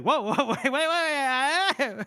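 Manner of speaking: high, loud, stammering alarm; comically panicked backpedalling; mock flustered protest. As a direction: scramble to stop someone, hammed up. Retold smug Baez suddenly flustered.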